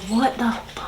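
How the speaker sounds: breathy aside under the breath; whispered disbelief. Retold or imitated. Sitting before the boss, reacting in whispered disbelief. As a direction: mouth it in shocked disbelief, almost silent.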